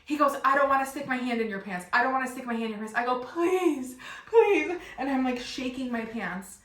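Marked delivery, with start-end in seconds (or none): 0.4-2.9 loud, higher acted protest; hammed-up balking, speeding up as it repeats; flustered refusal. Retells man refusing her frantic request. 3.2-4.8 high, loud drawn-out pleas; hammed-up panicked begging; desperate begging. Retells begging him for help.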